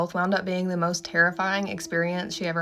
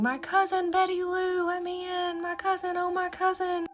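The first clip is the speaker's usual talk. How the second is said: high, wailing cries for her cousin; screaming and crying; 13 semitones higher than usual. Retold or imitated. Patient locked away, wailing for her cousin in terror. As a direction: plead high and desperate, near tears. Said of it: "Irma Jean screaming, crying, saying".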